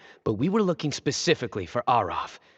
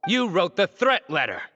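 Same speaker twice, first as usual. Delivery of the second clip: higher, pointed accusation; triumphant accusation, pitched up; accusing; 8 semitones higher than usual. Acted. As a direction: nail the culprit with it. Detective confronts hidden culprit.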